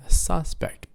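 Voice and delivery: a SUS-pect, strong then weak; first-syllable stress. Shown as a demonstration. Teacher confirms the noun, SUS-pect stressed up front. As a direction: lean on the first syllable of "suspect". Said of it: "first syllable".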